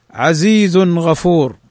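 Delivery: عزيز غفور slowly, tanwin nun clear before the ghain; slow clear reading. Shown as a demonstration. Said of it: "تنوين بعده الغين". (قراءة بطيئة لـ عزيز غفور مع إظهار التنوين)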